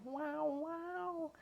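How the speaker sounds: high, quiet mock cat retch; imitating barf cry. Imitating the cat's barf cry for the friends. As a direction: mimic a cat about to puke. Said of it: "barf cry".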